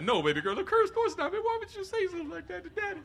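a dad caught out, pleading high; flustered dad. Retold or imitated. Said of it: "I close that computer immediately".

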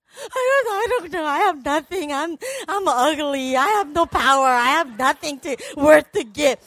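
loud, hammed-up self-pitying whine; mock insecure wail. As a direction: protest in exaggerated, wailing self-doubt. Acts out insecure reply to God.

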